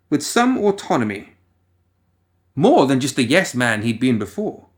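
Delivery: ordinary delivery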